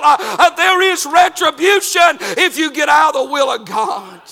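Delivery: loud, high, driving preacher's cry; full-force preaching. Acted. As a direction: preach it hard to the pews, pushing every line.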